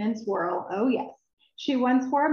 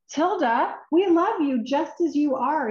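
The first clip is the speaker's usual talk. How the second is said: higher, louder, beaming with affection; cheerful friends; 6 semitones higher, 4 dB louder than usual. Acted. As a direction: friends reassuring Tilda, bright and eager. Friends comfort Tilda.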